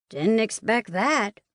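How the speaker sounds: unhurried, offhand remark; casual. Acted. Twin speaking casually from behind her, offhand. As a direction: toss it off casually, slowly. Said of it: "one of them says casually".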